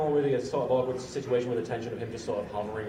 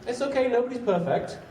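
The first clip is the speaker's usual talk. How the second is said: smug guy; 12 semitones higher than usual. Retold or imitated. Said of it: "this is what he said".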